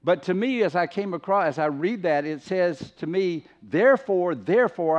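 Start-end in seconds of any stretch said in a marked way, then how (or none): 3.6-5.0 THEREFORE, THEREFORE, both hit higher and louder; emphatic repetition. Acted. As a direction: punch each "Therefore" hard, one after the other. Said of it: "I'm really making an emphasis here".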